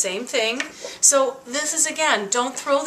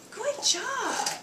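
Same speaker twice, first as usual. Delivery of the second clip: high sweet praise; dog praise; 13 semitones higher, 3 dB quieter than usual. Real, not acted. Owner praising Henry as he chews in a high coo.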